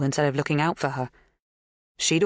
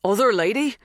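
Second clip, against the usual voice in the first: surprised echo; 7 semitones higher, 4 dB louder than usual. A narrator's reading. Dan echoes in surprise.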